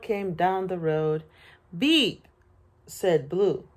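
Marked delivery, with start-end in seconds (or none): 1.8-2.3 short, higher friendly beep; little truck's beep. A narrator's reading. Little truck greeting a toad, playful beep.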